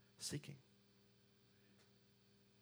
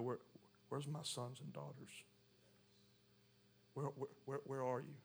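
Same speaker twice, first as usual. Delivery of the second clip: soft, halting plea; hushed searching; 6 dB quieter than usual. Acted. God looks for his lost children, hushed and searching. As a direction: search quietly, stumbling on "where". Preacher voices the searching Father.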